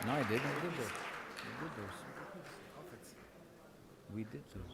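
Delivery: quiet aside away from microphone; murmured off to the side; off-mic mutter. Real, not acted. Off-mic aside between presentations.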